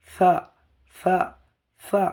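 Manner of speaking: short, isolated th-like সা; lisped articulation. Shown as a demonstration. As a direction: lisp সা with the tongue tip at the teeth. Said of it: "জিভের আগাটা গিয়ে উপরের দাঁতের সামনে লাগবে".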